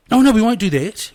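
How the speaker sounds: sudden loud self-correction, high; surprised. Real, not acted. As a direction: catch yourself, half laughing. Catches himself mid-sentence.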